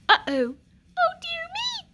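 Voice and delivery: high, startled dismay; dismayed cry. Acted. Queen spotting the puddle, dismayed. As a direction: gasp it out in alarm. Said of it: "cried the queen".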